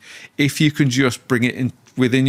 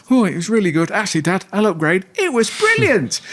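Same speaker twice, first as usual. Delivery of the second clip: put-on child's voice; 9 semitones higher than usual. Little kid telling dad school was brilliant, high child voice. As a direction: play the little kid upgrading the answer.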